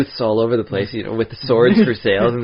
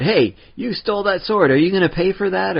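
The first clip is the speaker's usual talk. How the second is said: high, pitched-up mock shout of a shopkeeper; mock yell; 9 semitones higher than usual. Vendor shouting after a sword thief, high mock yell. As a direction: call out the thief, hammed-up and high. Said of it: "somebody yells out".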